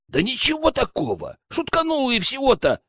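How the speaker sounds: high, defensive whine of excuse; protesting innocence. Acted. Accused lad excuses his joke to the leader, protesting.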